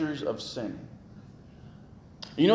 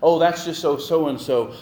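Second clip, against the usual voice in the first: the same excuse pushed out louder; hammed-up louder excuse, with a little more edge; louder mock excuse for bad behavior; 7 dB louder than usual. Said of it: "we make all these excuses".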